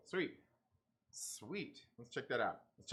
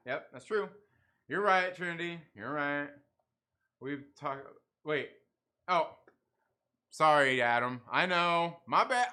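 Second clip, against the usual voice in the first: ordinary delivery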